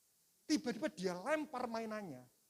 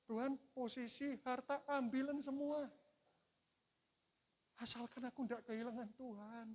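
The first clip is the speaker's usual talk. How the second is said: earnest prayer; 4 semitones higher, 4 dB quieter than usual. Retold or imitated. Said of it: "Apa yang membuat Daud berkenan".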